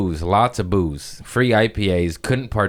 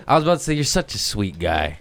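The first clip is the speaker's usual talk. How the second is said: usual speaking voice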